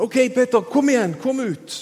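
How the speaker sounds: high acted call, urging someone on; Jesus kaller oppmuntrende. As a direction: call him out of the boat. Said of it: "så sier han". (oppmuntrende tilrop med høyere stemme)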